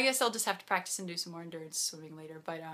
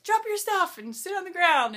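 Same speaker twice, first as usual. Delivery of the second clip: higher, louder voice of a volunteer giving orders; bossy volunteer; 10 semitones higher, 9 dB louder than usual. Retold or imitated. Volunteers telling the swimmer what to do, louder brisk order. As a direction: bark out the quick instruction.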